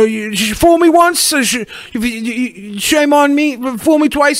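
mock presidential flub; George W. Bush voice. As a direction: stumble through the saying like the president. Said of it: "It was almost charming when he would say".

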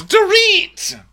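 slow, very high, loud cry; mock exasperated shout. Someone supplies the forgotten castmate's name, mock exasperated shout. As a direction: stretch the name in hammed-up exasperation.

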